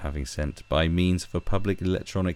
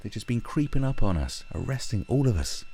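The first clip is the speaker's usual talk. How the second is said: normal talking voice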